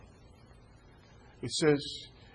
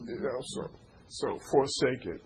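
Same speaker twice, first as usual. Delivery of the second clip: ordinary talk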